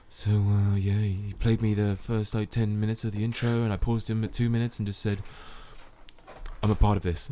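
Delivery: soft, low-key movie star murmur; lightly voiced Bruce Willis. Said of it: "I like the voice he did for him there".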